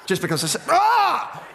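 parent snaps and yells, half laughing; hammed-up blowup at the kid; mock exasperated yell. Parent loses patience, shouting.

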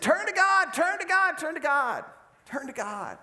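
high, loud cries that fade away; the prophet calls out from the well, growing faint; calling from the well. Acted. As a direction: call up from the bottom of the well, fading. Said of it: "won't stop preaching".

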